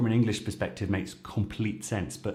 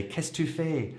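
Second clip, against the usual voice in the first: accusing tone; 7 semitones higher than usual. Shown as a demonstration. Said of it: "but you can also say".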